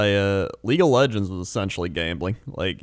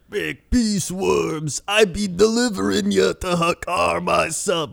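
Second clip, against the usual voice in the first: much higher, very slow, hammed-up troll voice; troll boss voice; 10 semitones higher, slower than usual. Friend imitates the ZG raptor boss, high hammy troll. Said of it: "he's like".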